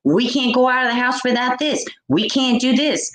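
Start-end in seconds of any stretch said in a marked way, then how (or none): none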